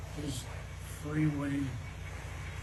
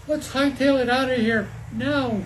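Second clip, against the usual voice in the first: high, raised storybook shout; urgent scream; 5 semitones higher, 4 dB louder than usual. A narrator's reading. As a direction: call out urgently to flee. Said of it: "Sawyer screamed".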